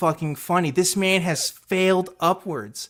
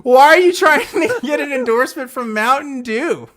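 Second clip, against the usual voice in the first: incredulous; 9 semitones higher, 7 dB louder than usual. Real, not acted. Incredulous at the quote.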